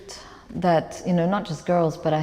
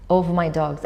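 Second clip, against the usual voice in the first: ordinary talk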